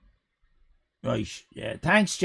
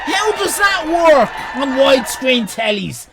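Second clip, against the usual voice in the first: loud shocked outburst; 7 semitones higher, 4 dB louder than usual. Real, not acted. Streamer shouting at a weird card shape in shock. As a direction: shout it in outraged disbelief.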